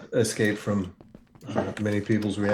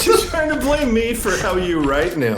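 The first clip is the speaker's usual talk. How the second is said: laughing; 6 dB louder than usual. Real, not acted. Laughing at the blame.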